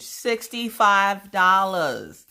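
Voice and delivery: SIXTY-FIVE DOLLARS loud, high and very slow; drawn-out outrage. Real, not acted. Telling viewers what Paw Paw's package cost, drawn-out and incredulous. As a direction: stretch each word of "sixty-five dollars" in disbelief.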